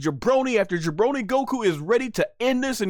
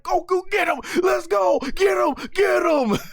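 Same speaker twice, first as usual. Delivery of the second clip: cheering him on; 10 semitones higher than usual. Retold or imitated. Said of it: "I was watching like".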